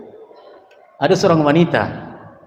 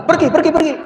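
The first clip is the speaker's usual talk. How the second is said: much higher, insistent shooing away; angry dismissal; 12 semitones higher, 3 dB louder, faster than usual. Retold or imitated. Grieving woman chasing off the Prophet, shrill angry repeats. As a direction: shove him off with repeated cries of go.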